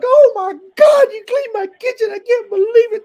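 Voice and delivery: very high, squealing falsetto overjoyed sister; mock overjoyed shriek of thanks; grateful sister squeal. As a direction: shriek with tearful joy. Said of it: "different kind of yell".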